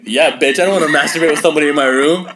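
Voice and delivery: loud, hammed-up indignant comeback; mock outrage. Joking reply to a creepy online ad, mock outrage.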